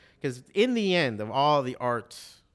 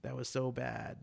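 soft, slow, glum verdict to himself; quiet disgusted self-talk; 9 dB quieter, slower than usual. Retold or imitated. Comic to himself after a bad set, a quiet glum mutter. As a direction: mutter it like you know it bombed.

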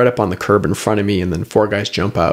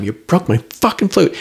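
raised, mock furious yell; retold yell; 7 semitones higher than usual. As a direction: shout it at the captors, pitched up. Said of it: "I'm screaming at them".

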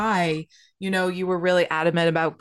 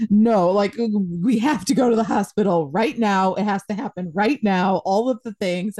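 louder, higher mock panic; imitating frantic husband; 4 semitones higher, 4 dB louder than usual. Wife imitates panicked husband insisting on the hospital, mock frantic. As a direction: ham up his rushed insistence. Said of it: "Kyle was like".

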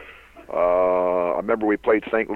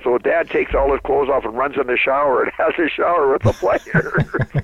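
laughing through it; 6 semitones higher, 4 dB louder than usual. Real, not acted. Laughing while telling funny story.

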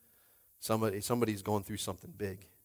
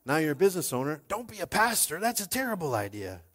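higher-pitched exasperated dad; imitated dad; 6 semitones higher than usual. Dad warning his child off becoming a pastor, higher exasperated voice. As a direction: voice a dad objecting, pitch climbing as he goes. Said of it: "he was like, when my dad told me".

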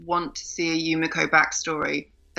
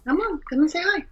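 higher sing-song pet talk; higher cooing call to a shy cat; coaxing a cat; 12 semitones higher than usual. Real, not acted. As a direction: coax the kitty over sweetly. Coaxing her cat to come.

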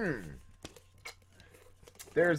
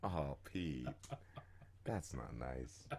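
soft mock scold; 21 dB quieter, much slower than usual.

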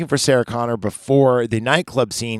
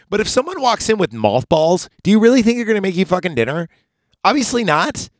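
incredulous rant; 9 semitones higher, 4 dB louder than usual. Real, not acted. Ranting at the dumb character.

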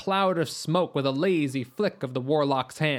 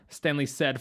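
usual speaking voice

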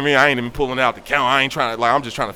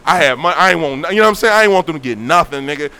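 ordinary talk, nothing marked

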